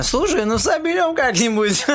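high, breezy shrug-off; carefree. Real, not acted. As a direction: toss it off without a care. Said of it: "Так ты прозвучал, как будто идёшь таксовать".

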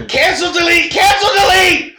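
high, loud panicked yelling; panicked shout. Retold or imitated. Reacting to a sent message, yelling in mock panic. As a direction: cry out in mock panic to stop it.

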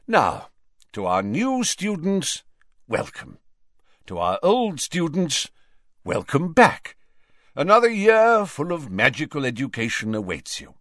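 headmaster's ringing welcome speech; Dumbledore, genial. A narrator's reading. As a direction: address the hall grandly, high with deliberate pauses.

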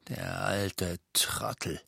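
leise, langsam gemurmelt; abfällig vor sich hin. Acted. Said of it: "murmelte Aberforth". (verächtliches Murmeln)

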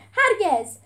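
loud, high cry of refusal; short, forceful yell; defiant shout. A narrator's reading. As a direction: hurl it back in defiance. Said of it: "جولیوس داد زد".

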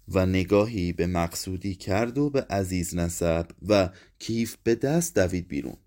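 ordinary delivery

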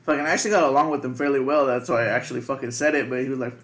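ordinary delivery

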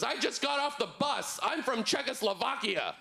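very high, hammed-up angry stranger; mock enraged. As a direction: imitate an outraged man protesting, pitch shooting up. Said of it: "he gets even more mad".

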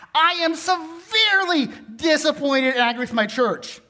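SEVERELY hammered hard; all caps emphasis. Retold or imitated. Pastor reading a harsh email to the congregation, mocking all caps. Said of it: "We need to make it all caps".

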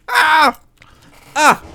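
loud high yells of release; venting shouts. Acted. Vlogger releasing bottled anger, shouting Ah. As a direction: let out frustration. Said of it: "I'm shouting a lot".

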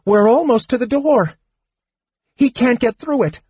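high-pitched urgent kender voice; panted urging. A narrator's reading. Kender urging mage toward door, high and frantic. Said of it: "Tasselhoff panted".

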